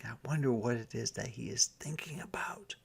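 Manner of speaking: fast hushed whisper; a quick curious whisper. Real, not acted. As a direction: whisper it to the listeners. Reader's whispered aside.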